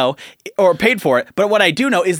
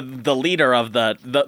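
ordinary talk, nothing marked

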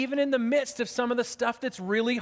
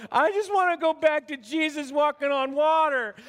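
higher, louder whiny student voice; overwhelmed students; 5 semitones higher, 3 dB louder than usual. Retold or imitated. Pastor mimicking college students overwhelmed by Revelation, whiny. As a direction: plead to go back to easy stories. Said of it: "they're going".

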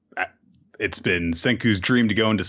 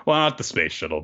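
ordinary talk

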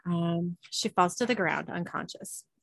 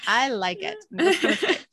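laughing; 6 semitones higher, 6 dB louder, much slower than usual. Real, not acted. Laughs at the line.

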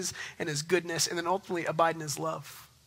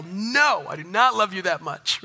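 mock yell; 2 semitones higher, 6 dB louder, slower than usual. Father jokingly yelling at daughter in bedtime game, mock yell. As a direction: yell it in jest to your kid. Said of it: "and I yell".

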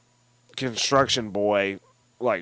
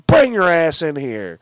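higher and louder barked order; retold scream; 5 semitones higher, 7 dB louder than usual. Retelling the ex-husband yelling at her, a loud order. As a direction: yell it like the furious ex-husband. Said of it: "screams at her and is like".

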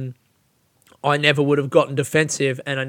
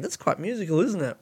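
ordinary talk

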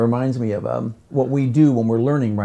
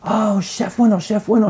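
excited calling out; 9 semitones higher than usual. Retold or imitated. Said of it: "she goes".

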